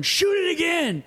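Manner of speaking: high, urgent shouted order; retold urgent yell. Marty in the water ordering Terrence to fire, high yell.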